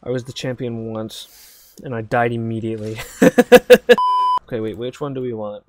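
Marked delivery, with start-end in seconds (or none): 3.0-4.4 high burst of laughter; loud laugh. Real, not acted. Big laugh at dying.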